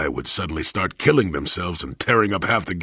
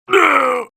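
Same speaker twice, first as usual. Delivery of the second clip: sharp pain cry; 7 dB louder than usual. Acted. Hit in combat.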